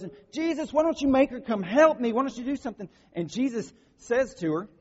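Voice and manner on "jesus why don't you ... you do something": higher, mock-exasperated complaint; imitating angry Martha. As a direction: play frazzled Martha pleading with Jesus. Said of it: "Martha gets really angry about this and goes".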